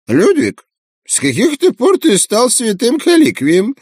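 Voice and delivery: high squawky snickering pelican; giggling pelican. Acted. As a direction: snicker it like a mocking bird. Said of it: "хихикнул старый пеликан".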